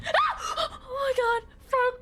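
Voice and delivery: sudden very high shriek then gasping oh my god; real jump-scare scream; scream of fright. Real, not acted. Jump scare in horror game.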